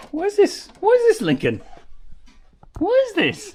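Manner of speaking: high, loud disbelief at the paper lamp; squeaky outraged what is this; incredulous. Real, not acted. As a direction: shriek it out in disbelief. Mocking flimsy paper hotel lamp.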